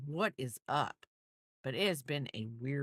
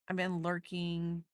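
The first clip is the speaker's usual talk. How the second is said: ordinary delivery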